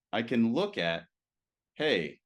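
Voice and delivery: ordinary talk, nothing marked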